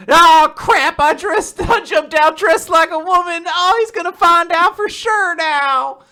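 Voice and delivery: loud, high comic panic; panicked character. Acted. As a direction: cry out as if your disguise is blown. Acts character panicking at being found out.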